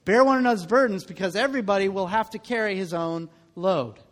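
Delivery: usual speaking voice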